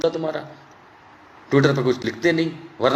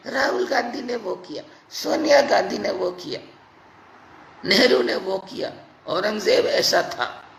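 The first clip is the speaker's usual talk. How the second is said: mocking imitation; 4 semitones higher than usual. Mocking chatter of armchair critics.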